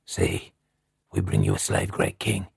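very low savage voice; barbaric ghostly cry. A narrator's reading. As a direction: address the great king as a savage spirit, pitched deep. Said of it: "they cried in the same barbaric tongue".

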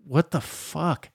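slow, soft, puzzled dog voice; drawn-out baffled aside from the dog; voicing the dog. The choked dog reacting to its owner, baffled. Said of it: "the dog is like".

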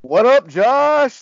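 re-enacted over-eager phone answer; chirpy phone greeting. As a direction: holler it like greeting a buddy for a chatty call. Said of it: "kinda funny the way I answer the phone".

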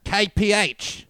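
high, slow mock Aussie; botched Australian accent. Mock officer giving kangaroo speed, botched Australian accent. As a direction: stretch each letter in a bad Australian accent. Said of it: "I think I'm doing New Zealand".

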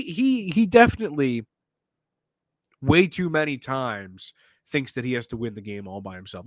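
usual speaking voice

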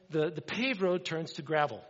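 ordinary talk

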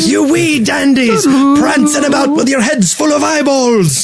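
high, slow Scottish Demoman growl; hammed-up Scottish Demoman taunt; Scottish accent. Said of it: "my demo man's not bad".

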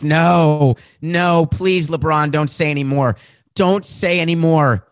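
mock-panicked commissioner begging LeBron to stop; pleading Adam Silver. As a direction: beg him to stop talking, panicked. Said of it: "my imitation of Adam Silver".

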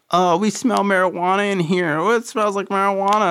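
put-on high, sing-song taunting kids; high nosy kid voice; mocking kid voice. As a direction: tattle like little kids sniffing the air. Kids mocked by narrator.

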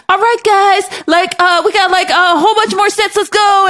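rally the class like a fitness coach; hyped coach. Retold or imitated. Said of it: "like, you're doing a whole".

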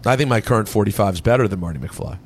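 ordinary talk, nothing marked

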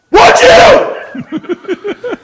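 high, loud demand; shouted question. Acted. Scolding sisters who post-gamed, shouted question. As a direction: bark the question at them.

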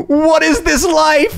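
high, slow amazed cry; drawn out in utter disbelief; stunned disbelief. Retold or imitated. Disbelief at the oil painting.

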